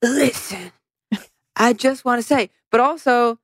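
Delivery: a light imitation of a Batman growl. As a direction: lightly put on a Batman hero voice. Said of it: "a Batman thing where he's like".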